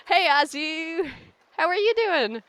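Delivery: high, bright-voiced host welcome, slow start; cheery innkeeper greeting. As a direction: greet the guest like a jolly innkeeper. Greeting Azu in character.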